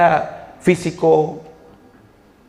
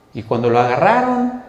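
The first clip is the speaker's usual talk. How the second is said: ordinary talk, nothing marked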